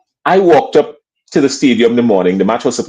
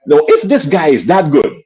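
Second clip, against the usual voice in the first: ordinary delivery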